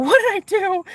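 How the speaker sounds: high, laughing through the line. Retold or imitated. Quoting the guy on the phone, laughing high. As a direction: giggle out the worried question. Guy worried he made her cry.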